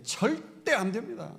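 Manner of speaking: Peter's outcry. Retold or imitated. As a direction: cry out the refusal in alarm. Peter refusing the cross again.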